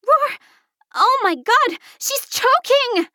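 very high, panicked scream. A narrator's reading. Taylor seeing her friend choking, screaming. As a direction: shriek in alarm, stretching the words. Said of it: "Taylor screamed".

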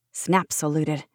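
ordinary delivery, nothing marked

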